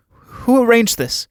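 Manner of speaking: high, slow, demanding question; startled demand. Acted. Judith demanding who arranged Alan's leaving, startled and insistent. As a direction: draw it out high in disbelief.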